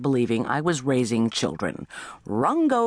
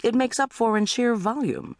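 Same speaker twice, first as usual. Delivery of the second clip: ordinary delivery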